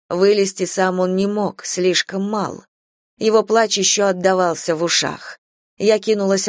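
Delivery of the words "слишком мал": ordinary delivery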